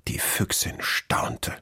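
ordinary delivery, nothing marked

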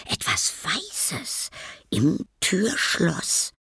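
slow, whispered and mysterious; eerie whisper. A narrator's reading. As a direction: breathe it out slowly. (langsam und geheimnisvoll geflüstert)

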